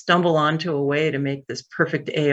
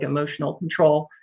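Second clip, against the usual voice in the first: ordinary talk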